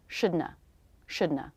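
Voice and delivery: shouldna said SHOOD-nuh; casual shouldna demo; reduced shouldna. Shown as a demonstration. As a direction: drop not, just an N then schwa. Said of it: "Drop the word not and just make an N sound".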